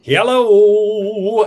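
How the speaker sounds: loud, high-pitched welcome; big hello. Real, not acted. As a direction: belt out the greeting. Podcast host's opening greeting.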